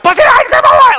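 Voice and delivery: very high, loud frantic shriek; screaming. Acted. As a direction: lose it completely and scream. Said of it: "I'm screaming about ice cream".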